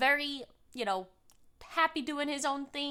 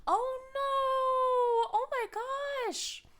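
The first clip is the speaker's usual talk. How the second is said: sympathetic oh no; 12 semitones higher, 3 dB louder, much slower than usual. Real, not acted. Hearing about dental appointments.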